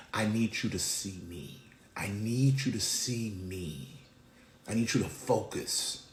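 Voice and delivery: low, soft, deliberate plea; God's gentle insistence. Acted. God speaking to the distracted, soft low insistence. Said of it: "God is grabbing our faces and saying".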